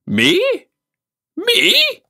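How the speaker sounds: high, loud, offended disbelief; shocked exclamation. Acted. Uncle offended by the boy's question, shrill surprise. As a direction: recoil as if insulted. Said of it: "he exclaimed".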